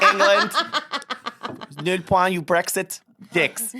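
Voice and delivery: loud, high, laughing jeer at England; mock Eurovision verdict. Mocking England in the Eurovision scores, laughing jeer. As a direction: sneer the score out like a Eurovision host.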